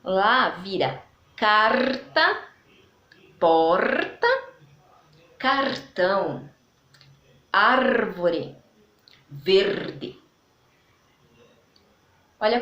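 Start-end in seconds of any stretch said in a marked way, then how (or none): 1.3-10.2 "porta" and "árvore" with a tapped front R, "verde" ending in a clear e; gaúcho R. Shown as a demonstration. Teacher showing students the southern gaúcho R, tapped R. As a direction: tap the R in "carta, cartão" the Rio Grande do Sul way. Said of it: "o R é bem mais puxado".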